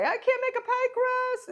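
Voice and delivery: high squeaky imitation of a distressed baker; playful mock wail of despair; upset subscriber. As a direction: mimic a near-tearful plea, pitched high.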